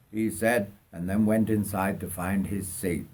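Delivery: usual speaking voice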